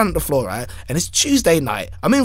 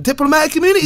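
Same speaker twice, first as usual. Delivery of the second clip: mock proclamation; 10 semitones higher, much faster than usual. Joking claim of immunity.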